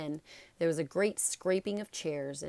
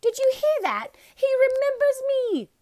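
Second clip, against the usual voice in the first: thrilled cry; 14 dB louder than usual. A narrator's reading. Diggle cries out to everyone, thrilled Harry remembers, high and loud. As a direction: shriek it like he's won a prize.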